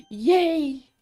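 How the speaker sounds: a loud, high burst of joy; excited cheer. Real, not acted. Solving the maze step, she cheers loudly.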